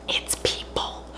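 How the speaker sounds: ominous whisper. Real, not acted. Revealing the family's dinner to viewers, creepy whisper. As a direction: hush it like a spooky secret.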